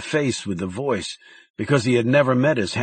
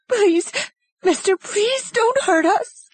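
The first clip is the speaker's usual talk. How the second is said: begging mother; slower than usual. A narrator's reading.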